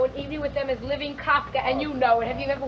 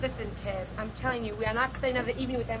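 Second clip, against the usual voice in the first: much softer, fast hissed warning; low insistence; 5 semitones lower, 10 dB quieter, faster than usual. Acted. Wife warns husband, soft and fast. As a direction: press it quietly and fast.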